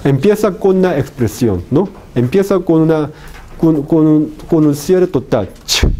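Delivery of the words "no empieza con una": ordinary delivery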